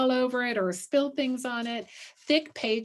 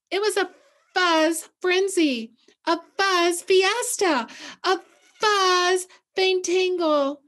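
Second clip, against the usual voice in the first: lively read-aloud; 8 semitones higher, 7 dB louder than usual. A narrator's reading. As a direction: relish the fuzz words for the kids. Building read-aloud rhythm.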